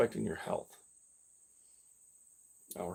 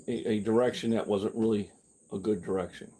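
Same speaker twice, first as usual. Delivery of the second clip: ordinary talk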